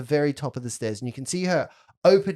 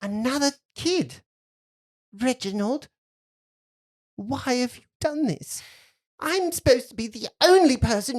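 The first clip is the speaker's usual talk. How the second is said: put-on higher-pitched, aggrieved child's whine; petulant little girl; 10 semitones higher than usual. Girl to playmate about her horrible uncle, petulant. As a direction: complain like a neglected child.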